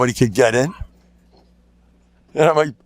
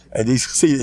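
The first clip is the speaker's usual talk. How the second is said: ordinary delivery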